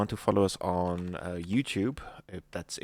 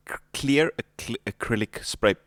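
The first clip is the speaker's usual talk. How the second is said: ordinary talk, nothing marked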